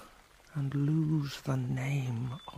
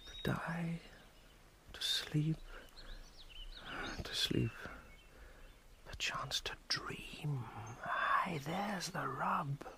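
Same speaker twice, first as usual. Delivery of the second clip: whispering; 2 semitones higher, 6 dB quieter than usual. Acted. Whispered soliloquy.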